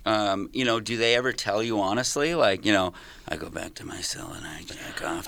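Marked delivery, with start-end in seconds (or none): 3.3-5.3 hushed, breathy confession; whispering. Retold or imitated. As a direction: whisper it low. Quoted inmate, whispered.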